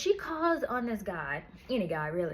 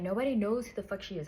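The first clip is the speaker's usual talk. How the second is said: normal talking voice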